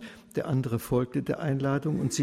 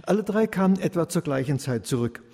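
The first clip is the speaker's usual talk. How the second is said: usual speaking voice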